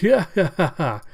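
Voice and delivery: loud, high whoop into laughter; villain's gleeful cackle. Acted. Cyborg gloats over fleeing victims, gleeful cackle. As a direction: cackle in wicked triumph.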